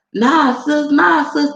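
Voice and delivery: hammed-up high roller urging bigger bets; pushy big baller. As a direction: egg her on to bet bigger. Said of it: "It was like".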